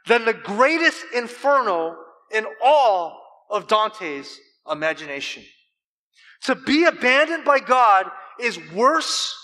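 loud, high, slow emphatic preaching; preaching at full force. Acted. Preacher drives home the bitter cup to the congregation, loud and slow. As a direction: thunder out God's wrath.

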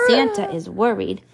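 plain reading voice

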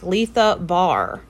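"Letha Bar" said slowly, each syllable spelled out; slow careful name. Shown as a demonstration. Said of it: "I have to be like".

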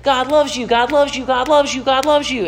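mock the preachers who only say this; mocking repetition. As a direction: parrot it like an empty slogan. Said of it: "they're uncomfortable with anything".

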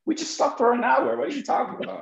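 high mock-incredulous voice; baffled stranger. Stranger who just talked an hour, mock baffled and high. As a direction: squeak it out like someone who can't believe it. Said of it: "They'll be like".

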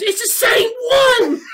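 put-on high, loud kid outburst; shrieking child. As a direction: shout it like an astounded child. Retold child shouting at the reveal.

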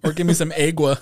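gringo Spanglish. Shown as a demonstration. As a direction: ask with a deliberately gringo "agua". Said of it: "when I speak Spanglish".

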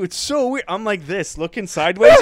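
normal talking voice

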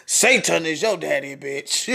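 usual speaking voice

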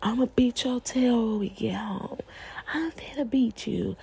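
lightly voiced threatening mom; mock scolding parent. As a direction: imitate a parent hissing threats in public. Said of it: "yelling at them in front of everybody".

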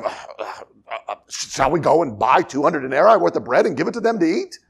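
stammering start into a questioning rise; flustered. Acted. Disciples answering Jesus' impossible command, flustered and stammering.

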